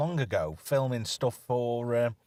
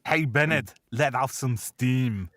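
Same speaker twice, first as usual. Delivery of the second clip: slow, lightly Austrian Arnie line; a hint of Arnie's deadpan; Arnie quote, misquoted; 3 semitones higher, 4 dB louder, slower than usual. Host quoting Commando, slow Arnie deadpan. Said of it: "Actually, the line is".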